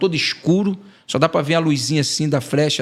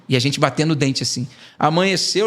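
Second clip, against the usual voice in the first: usual speaking voice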